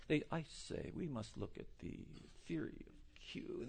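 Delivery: hushed posh British lecturer; mock British accent. Boring Oxford lecturer addressing students, mock British accent. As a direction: put on a softly spoken, boring British don. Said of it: "They sit there, and as boring as they are".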